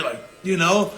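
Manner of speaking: ordinary talk, nothing marked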